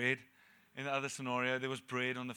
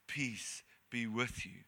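gentle calm; 5 semitones lower, 3 dB quieter, much slower than usual. Acted. Jesus greeting startled disciples.

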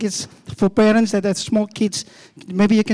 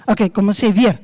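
normal talking voice

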